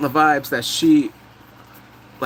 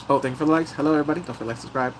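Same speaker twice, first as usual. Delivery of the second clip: ordinary delivery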